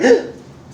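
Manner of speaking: imitating the wife's sharp frightened gasp; a loud startled intake of breath; mock gasp of alarm. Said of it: "She's like".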